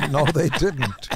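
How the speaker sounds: laughing through the words. Real, not acted. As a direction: say it while cracking up. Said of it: "laughs while saying".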